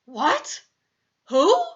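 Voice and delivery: startled questions, jumping up high and loud; voice gone funny. A narrator's reading. Father hears who is phoning, voice goes all funny. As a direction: blurt it in rising disbelief. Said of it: "his voice goes all funny".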